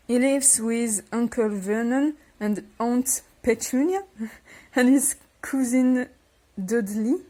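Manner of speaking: slow, careful English read with a French accent; reading aloud in English. Shown as a demonstration. French youtuber reading the English book blurb to viewers, slow and careful. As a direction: read the blurb out slowly, word by word.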